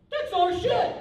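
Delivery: high, loud retold outburst; hammed-up indignant yell; mock indignant shout. Retold indignant phone exchange.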